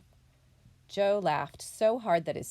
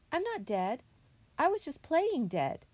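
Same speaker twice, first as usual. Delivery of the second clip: high little-character voice; young possum explaining; 9 semitones higher than usual. A narrator's reading. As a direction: own up in a raised, childlike pitch. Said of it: "he called".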